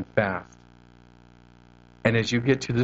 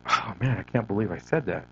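low, soft dismay; regretful self-talk; 4 semitones lower, 8 dB quieter than usual. Acted. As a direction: mutter it to yourself in regret. Voicing regretful self-talk afterwards.

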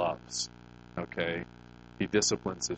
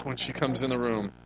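normal talking voice

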